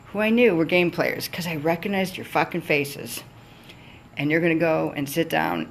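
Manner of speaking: ordinary talk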